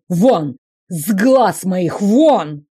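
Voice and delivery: loud, slow furious shouts; having a heart attack. Acted. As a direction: throw him out, clutching the heart. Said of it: "Он схватился за сердце".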